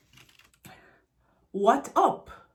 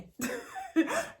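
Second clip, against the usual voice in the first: high, light giggle; 7 dB quieter than usual. Real, not acted. Teacher amused at her own demo, high giggle. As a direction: break into a high squeaky laugh. Laughs at own hand gesture.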